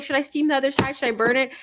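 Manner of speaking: high, anxious questions in a friend's voice; lightly voiced fretting friend; flustered friend. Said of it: "She's like".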